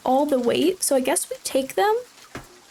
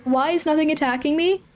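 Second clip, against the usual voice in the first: ordinary talk, nothing marked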